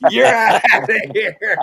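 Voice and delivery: loud, high, drawn-out umpire's yell; hammed-up umpire shout through laughter; mock ejection call. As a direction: holler it like an umpire tossing a player. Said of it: "And he went".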